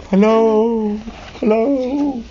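high, drawn-out hello; high-pitched character call. Acted. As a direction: sing out a greeting from a hiding place. Unseen voice calls from drawers.

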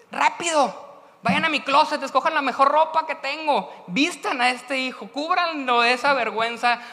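high, loud joyful commands; father shouting orders in joy; excited father. Acted. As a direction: rush the servants out with joy.

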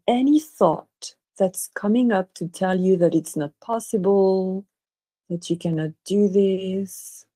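unhurried meditation guide, long pauses between phrases; guided meditation. Real, not acted. Guiding listeners to relax and imagine, guided meditation. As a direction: lead them gently through it, leaving space after each instruction.

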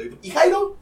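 imitating mom. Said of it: "Y ya me preguntó".

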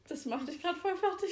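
teasing through giggles; laughing. Real, not acted. Teasing the co-host about her reading slip, laughing.

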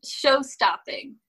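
slow, drawn-out, awed praise; [name]'s voice. Retold or imitated. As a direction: stretch each word like a diva's verdict. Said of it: "like, [name]'s voice from that meme".

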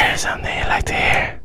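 hushed, voiceless mock-spooky line; playful whisper. Host joins in as the voices, playful whisper. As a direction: keep it all in a whisper. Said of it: "Whisper in your ear".

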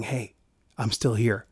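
soft, hushed voice of God; barely above a murmur, tender; still small voice. Acted. God gently reassuring the doubting believer, hushed. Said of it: "It's that still small voice that's saying".

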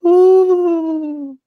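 high loud ghostly ooh; ghost owl hoot. Retold or imitated. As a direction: imitate a spooky owl call. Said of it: "the one that goes".

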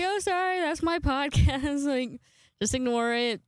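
sheepish laugh through the apology; embarrassed apology. Retold or imitated. Apologizing to coworker Pat for her podcast, embarrassed and laughing. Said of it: "embarrassedly saying".

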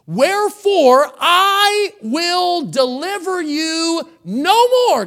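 high, loud and slow pronouncement; voicing God's stern refusal. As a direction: thunder it as God from the pulpit. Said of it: "God says".

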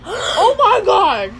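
slow, high gasping oh my god; drawn-out shock. Real, not acted. Friend after a gasp, slow and shocked. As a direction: stretch it out in disbelief.

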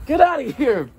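loud and high, shooing away. Acted. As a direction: shout them off, high and loud. Shooing someone off.

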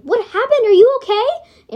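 ordinary delivery

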